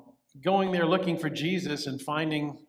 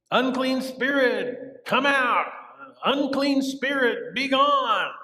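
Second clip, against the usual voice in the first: higher, louder, slow commanding cry; commanding exorcism; 9 semitones higher, 5 dB louder, slower than usual. Acted. Disciples trying to cast out a spirit, loud command. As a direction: order the spirit out with full force.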